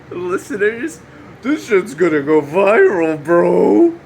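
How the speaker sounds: loud, high hyped-up bro voice. Acted. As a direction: yell it like an overexcited influencer.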